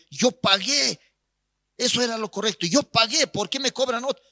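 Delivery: high, insistent mock complaint; imitating an aggrieved complainer; mimicked protest. Pastor mimics the man's complaint.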